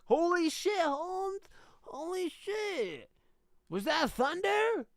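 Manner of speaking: put-on high, panicked Mexican accent, slow and stretched. Terrified Aztecs hearing cannons for the first time, Mexican accent panic. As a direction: squeal it in a frantic Mexican accent. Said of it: "doing my best to do a Mexican accent".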